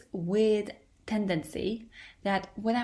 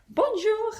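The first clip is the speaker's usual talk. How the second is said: "Bonjour!" shouted much higher and louder in a mock French accent; shouted French greeting; 7 dB louder than usual. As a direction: belt out "Bonjour!" on entering the shop. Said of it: "I shout".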